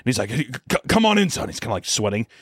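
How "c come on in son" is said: nervous dad. Retold or imitated. Nervous father sweating.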